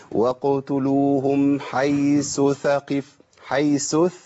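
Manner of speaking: slow chanted "وقتلوهم حيث", the first tha sounded as s; common recitation mistake. Retold or imitated. Teacher imitating people who muddle it, slow chant with "حيث" as "haysu". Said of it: "نلاقي ناس بتقول".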